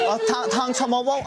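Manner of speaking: a playful second go at the Irish phrase; attempted Irish accent. Shown as a demonstration. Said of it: "the cutest thing".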